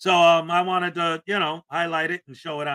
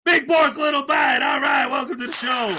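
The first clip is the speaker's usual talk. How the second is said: excited shout-out; 6 semitones higher, 4 dB louder than usual. Real, not acted. Reading a donation, calling out.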